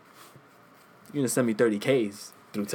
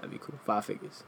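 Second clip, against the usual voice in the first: whispering; 3 semitones lower, 16 dB quieter than usual. Real, not acted. Dreamy aside.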